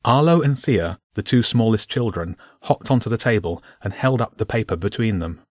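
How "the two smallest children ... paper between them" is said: ordinary delivery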